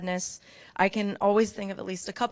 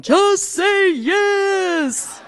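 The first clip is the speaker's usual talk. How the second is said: high, very slow drawn-out plea; David urging; 11 semitones higher, 7 dB louder, much slower than usual. Retold or imitated. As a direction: stretch it out high, coaxing. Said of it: "David's in the background going".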